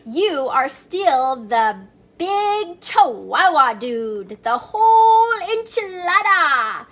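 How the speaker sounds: hammed-up Spanish accent, slow then loud. Kitten boasting to the mirror in a mock Spanish accent. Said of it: "using his very best Spanish accent".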